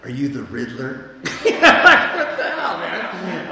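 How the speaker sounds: high, loud, laughing disbelief; laughing "what the hell" at the store owner; mock incredulous laugh. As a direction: scoff at him as if he's speaking in riddles. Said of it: "I go".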